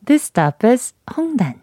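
loud, clear station announcement; subway announcement. Shown as a demonstration. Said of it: "잘하네".

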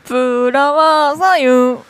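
slow, exaggerated singsong teasing; mock teasing. Host teasing co-host for being envious, drawn-out mock pity. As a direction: stretch each word out in mock pity.

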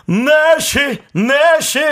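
loud jingle call. Acted. Hosts cue the station jingle.

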